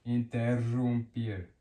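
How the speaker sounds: slow "Interrumpir" with a strong trill; rolled RR. Shown as a demonstration. Teacher repeating the example for learners, "Interrumpir" slowly with rolled rr. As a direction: stretch the word "Interrumpir" and roll its rr. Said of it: "makes it stronger and rolled".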